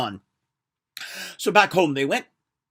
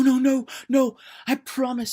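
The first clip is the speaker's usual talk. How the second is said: much higher, fast and loud; panicked denial; 9 semitones higher, 9 dB louder than usual. Acted. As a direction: deny it in terror. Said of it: "said Old Man Jack".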